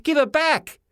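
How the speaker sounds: high shouted no; yell. A narrator's reading. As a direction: yell in protest. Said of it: "I yelled".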